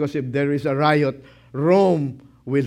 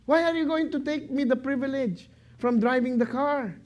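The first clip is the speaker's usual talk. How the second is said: put-on whiny child; 9 semitones higher than usual. Preacher voices a pleading child.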